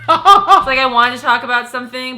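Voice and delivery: loud laughing outburst; laughing shout. Real, not acted. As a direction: blurt it out through laughter.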